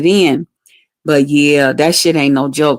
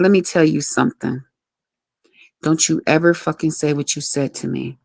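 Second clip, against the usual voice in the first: softer, lower, controlled anger; low stern warning; 3 semitones lower, 6 dB quieter than usual. Retold or imitated. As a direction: retell a warning with restrained menace. Retold warning to boyfriend in quarrel.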